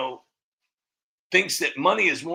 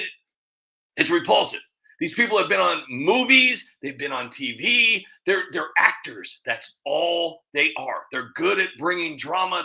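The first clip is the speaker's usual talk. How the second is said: ordinary talk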